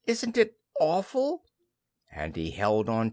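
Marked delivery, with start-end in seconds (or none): 0.1-1.4 high, squeaky dismay; high-pitched frightened small animal; anxious Piglet. Acted. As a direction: fret aloud as a tiny piglet. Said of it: "said Piglet anxiously".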